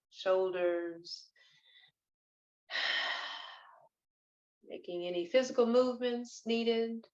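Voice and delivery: unhurried, hushed breathwork narration; guided meditation. Acted. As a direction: lead them gently into stillness, phrase by phrase.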